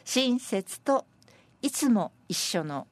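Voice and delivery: slow, spaced-out verse reading; senryu recitation. Acted. Presenter reading senryu on kindness and meddling, measured recitation. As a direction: recite it phrase by phrase, pausing between phrases.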